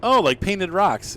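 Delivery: excited recognition. Real, not acted. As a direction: draw out the oh as it clicks. Spots the rocks on screen.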